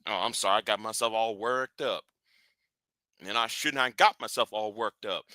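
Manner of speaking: low, very soft, reined-in voice; catching oneself after an outburst, hushed and low; subdued apology. Real, not acted. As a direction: drop right down and apologize quietly. Apologizes right after his outburst.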